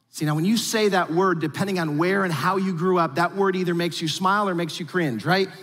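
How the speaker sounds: usual speaking voice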